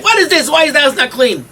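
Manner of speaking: high, loud scolding of the wife; mock-indignant husband griping about the mess; complaining husband. Husband scolds his tired wife over an untidy house, loud and high. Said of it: "You say to your wife".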